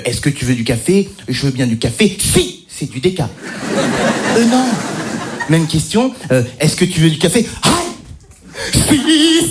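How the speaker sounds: ordinary delivery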